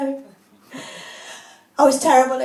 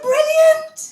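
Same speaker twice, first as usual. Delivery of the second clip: high, loud, thrilled squeal; overjoyed; 9 semitones higher, 5 dB louder than usual. Retold or imitated. To the man who said she sounds brummie, a high loud excited squeal. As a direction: burst out delighted, revving like a sports car.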